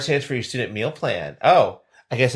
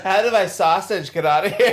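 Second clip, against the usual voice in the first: giggling mock-innocent question; laughing repeat; 7 semitones higher than usual. Cohost repeating the sausage line, laughing. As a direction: echo the joke while laughing.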